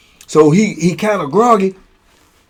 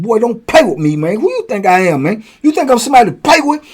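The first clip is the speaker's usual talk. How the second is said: raised, pushy retold threat; a retold prison threat, raised and fast at the end; mock threat; 6 semitones higher than usual. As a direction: lean on him, daring him. Retold threat while choking a cellmate.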